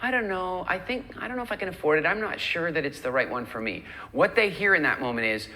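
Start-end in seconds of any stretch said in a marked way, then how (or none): 0.0-3.9 higher-pitched, unsure dithering; wishy-washy indecision; hesitant buyer. Acted. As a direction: waver as if you could be talked into it. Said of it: "you just sounded like, you know, you walk in".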